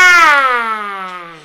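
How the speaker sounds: long, low falling "ah"; drawn-out low prophet's wail. Acted. Prophet's long trance-like cry.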